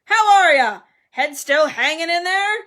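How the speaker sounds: very loud, high, booming greeting; hearty roar. A narrator's reading. Sir Patrick ribbing Nick about his head, roaring. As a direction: boom it out jovially. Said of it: "he roared".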